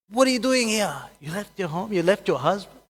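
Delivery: high, loud indignant family member; outraged scolding. Acted. Family scolds daughter who left.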